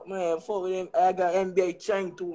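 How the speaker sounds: usual speaking voice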